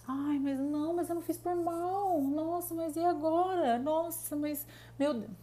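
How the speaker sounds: high, whiny victim voice; playing the helpless kitten. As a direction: whimper like a cornered little kitten. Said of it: "ela vira aquele gatinho". (imitando a vítima frágil, voz bem aguda e chorosa)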